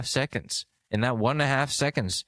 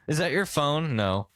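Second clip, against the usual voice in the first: usual speaking voice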